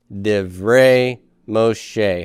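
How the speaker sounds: "Divrei Moshe" drawn out syllable by syllable; slow say-along. Shown as a demonstration. Teacher leading students in saying it together, slow say-along. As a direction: lead the "Divrei Moshe" repeat very slowly. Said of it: "Say that with me".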